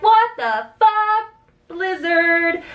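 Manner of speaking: high, drawn-out mock shock; stunned disbelief. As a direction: stretch each word high, utterly incredulous. Retold shock at sudden snowstorm.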